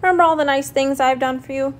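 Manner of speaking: high, quick reminder; indignant, pleading question; reproachful. A narrator's reading. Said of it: "said Señor Coyote reproachfully".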